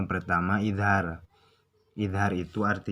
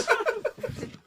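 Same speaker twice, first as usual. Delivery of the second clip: laughing. Real, not acted. Laughs at shaky video.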